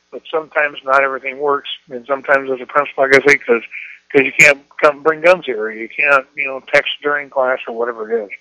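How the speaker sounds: usual speaking voice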